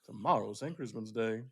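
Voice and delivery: slow theatrical drawl opening, then settling; Southern-accented Shakespeare. Pretend. Reading Shakespeare for the cohost, slow mock Southern recital. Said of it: "read some Shakespeare".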